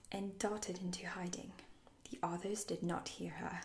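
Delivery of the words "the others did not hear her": usual speaking voice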